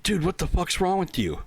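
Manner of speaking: high, disgusted mock coworker; imitating an appalled colleague; grossed-out coworker. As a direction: recoil from the smell and demand answers.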